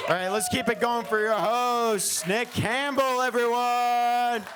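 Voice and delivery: high, rallying announcer call; stage send-off. Real, not acted. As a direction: whip up applause for the host, stretching the last word. Comic hands back to the host.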